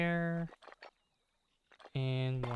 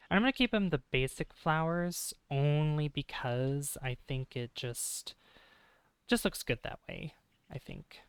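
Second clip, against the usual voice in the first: ordinary delivery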